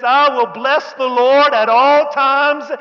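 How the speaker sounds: high, loud, drawn-out praise; exultant shout. Acted. Preacher proclaiming David's last cave psalm, shouted slowly. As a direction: stretch each word into a ringing preacher's shout.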